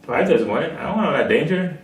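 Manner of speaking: ordinary talk, nothing marked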